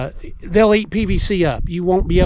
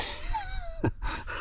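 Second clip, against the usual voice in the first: short laugh; 16 dB quieter than usual. Real, not acted. Laughs at own remark.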